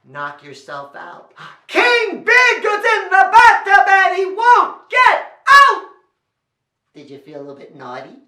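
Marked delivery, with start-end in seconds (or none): 1.7-5.9 high, full-voiced shout; loud. Shown as a demonstration. Storyteller leading children in a library, loud shouting. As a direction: bellow the refrain word by word. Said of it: "how loudly can you say".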